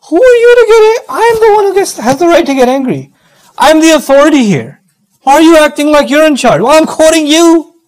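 high-pitched indignant outburst; mock angry parent. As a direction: act out a parent outraged at losing authority. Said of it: "If I'm yelling at one of my kids".

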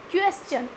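क्वेश्चन in two beats, "kwes - chun"; correct pronunciation. Shown as a demonstration. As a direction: clear "ch" in क्वेश्चन after a break. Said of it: "नहीं होगा". (क्वेश्चन को "क्वेस-चन" बोलें)

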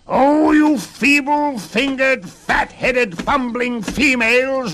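very high, loud scolding dwarf; little man throwing a fit; furious roar. A narrator's reading. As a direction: roar the insults in a high-pitched tantrum. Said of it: "roared the little man".

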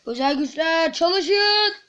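summoning his special powers, slowly; mock incantation. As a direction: cast it like a cartoon wizard. Mock spell to activate powers.

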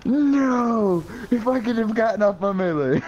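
high-pitched wail of frustration; exasperated, high and drawn-out protest; anguished gamer outburst. Real, not acted. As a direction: cry out at a botched move. Dies before getting melee off.

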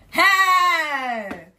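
sudden high loud call; a thrilled shout at the box; excited hey. Real, not acted. YouTuber calling out over her package, excited.

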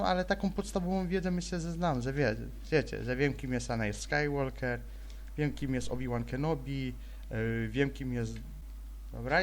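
normal talking voice

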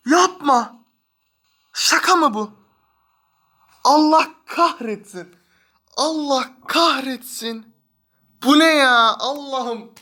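high, loud angry despair; frustrated yelling. Real, not acted. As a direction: shout curses in frustration. Pack gives wrong player.

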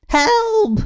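very high witch's cry; crying out for help. A narrator's reading. Witch fleeing the dragon's fire, crying for help. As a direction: squeal it high, as a plea for rescue. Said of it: "cried the witch".

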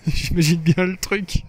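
amused, talking through a laugh. Real, not acted. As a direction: crack up at your own joke. Laughs at own fake accent.

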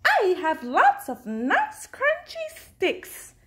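high, sing-out offer from a beaver; eager Beaver. A narrator's reading. Reader voices Beaver.

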